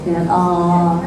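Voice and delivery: ordinary delivery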